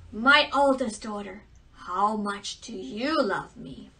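loud regal call to the court; king calling out. Acted. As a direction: summon the daughters like a proud king. King summons his daughters.

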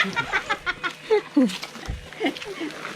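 bright, high laughter. Real, not acted. Group laughing on the walk.

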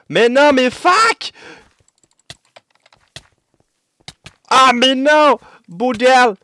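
high, loud and drawn-out groaning; frustrated shout. Real, not acted. Losing the chase in game.

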